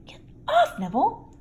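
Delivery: high, sharp brush-off; irritated shake-off. A narrator's reading. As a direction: shrug him off, annoyed. Harry irritated.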